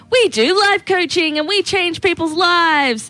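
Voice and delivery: drawn-out, self-important imitation of a life coach; mock life coach. Co-host mimicking people who turned life coach, mocking.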